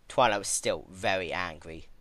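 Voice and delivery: ordinary delivery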